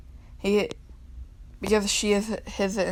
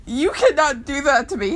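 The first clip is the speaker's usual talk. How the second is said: mock outrage; 6 semitones higher, 6 dB louder than usual. Ranting at Hamilton over song.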